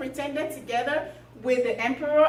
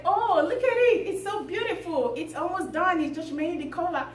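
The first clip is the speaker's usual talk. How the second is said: higher, gushing show-off; acted admiration; 6 semitones higher than usual. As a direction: show off the cloth with put-on delight. Weavers showing off the invisible cloth.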